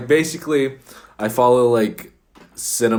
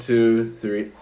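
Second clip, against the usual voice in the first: ordinary talk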